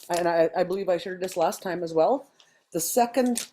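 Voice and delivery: normal talking voice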